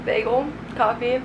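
ordinary talk, nothing marked

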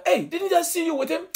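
very high questioning voice; suspicious bystander. Retold or imitated. Another man questions Peter.

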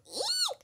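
soft, very high-pitched squeal; cried squeal. A narrator's reading. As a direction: let out a tiny, high "eek" cry. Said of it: "someone cried".

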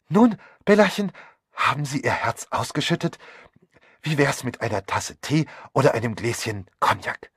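high, loud, fussily cheerful woman; gushing, nosy hostess. Acted. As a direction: burst in with effusive solicitude. (überschwänglich betuliche Gastgeberin)

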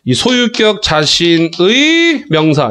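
teacher's demo, 자신의 held long into 명사; linked reading of 자신의. Shown as a demonstration. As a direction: stretch the end of 자신의 up, then drop onto 명사. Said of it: "이런 식으로 읽습니다".

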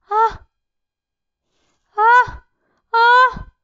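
three jumpy startled screams; startled yelps. Real, not acted. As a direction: yelp in real alarm. Startled by enemies in game.

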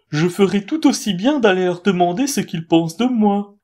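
a conceited owl thinking aloud; vain owl. A narrator's reading.

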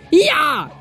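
high, drawn-out triumphant yell; excited cheer. Real, not acted. Gamer finally landing hits, excited cheer. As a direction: whoop as the blow lands.

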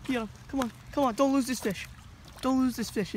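high, urgent, repeated calls; frantic cheering. Real, not acted. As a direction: urge the angler on, really worked up. Urging on the catch.

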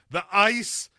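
loud, high Dead Zone outburst; hammed-up impression, sudden high yell; Christopher Walken. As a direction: shout it as a movie psychic's warning. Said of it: "like, Christopher Walken, you know, Dead Zone".

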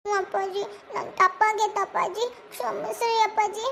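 put-on slow, sorry child in tears; tearful. Child begs father's forgiveness, tearful. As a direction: apologise haltingly through tears. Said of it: "ಹಂಗೆಲ್ಲ ಕಣ್ಣಾಗ್ ನೀರ್ ಹಾಕ್ಬಾರ್ದು".

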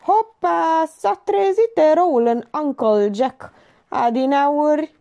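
high, slow drawn-out jeer; exaggerated mock surprise. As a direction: draw the words out to tease the so-called hero. Joker mocks waking hero.

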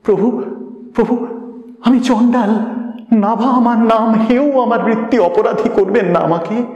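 high-pitched, anxious protest; frightened pleading. A narrator's reading. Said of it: "সে ভীত হয়ে বললে".

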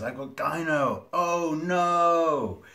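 loud, high mock wail, stretched out; mock dismay. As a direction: wail it in exaggerated horror. Mock dismay at own diagnosis.

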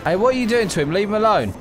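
normal talking voice

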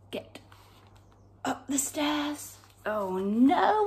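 usual speaking voice

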